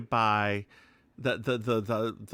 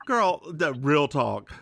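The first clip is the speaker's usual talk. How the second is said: ordinary talk, nothing marked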